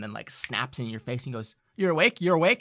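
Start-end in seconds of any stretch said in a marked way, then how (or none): 1.8-2.6 high, loud urgent questions; anxious Kurt. Acted. Kurt shaking Winslow awake, high and loud. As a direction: rouse him with nervy urgency.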